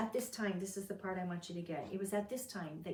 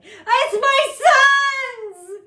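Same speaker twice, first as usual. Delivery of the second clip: much higher and louder, slow, crying out; anguished wailing cry; 21 dB louder, much slower than usual. Retold or imitated. Jacob recognises his son's bloody robe, wailing shout. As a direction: wail it like a father who just lost his son. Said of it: "Only there's an exclamation point there".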